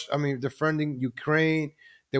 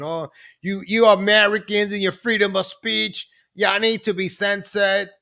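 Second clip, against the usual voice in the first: hammed-up Australian, pitched up and stretched; bad Australian accent; 6 semitones higher, 3 dB louder than usual. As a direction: scold in a badly faked Aussie accent. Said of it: "I gotta, I can't do it".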